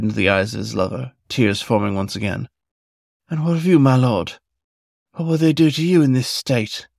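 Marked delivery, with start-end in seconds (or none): 3.3-6.9 higher, pleading fox voice; tearful young fox. A narrator's reading. Fox in tears asking Hook what will become of him, higher pleading voice. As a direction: fear for his lover in every word.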